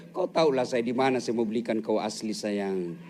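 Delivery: low, soft, coaxing reassurance; tender husband. Acted. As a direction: soothe her gently, dear. Husband soothing wife. (suami membujuk istri dengan suara rendah dan lembut)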